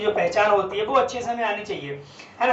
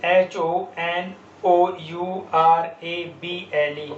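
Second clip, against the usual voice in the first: slow letter-by-letter spelling; teacher spelling it slowly; spelled out slowly; 3 semitones lower, much slower than usual. Shown as a demonstration. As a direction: spell each letter out deliberately. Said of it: "spelling letters slowly".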